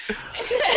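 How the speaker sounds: high burst of laughter; real laugh. Real, not acted. Laughing at story.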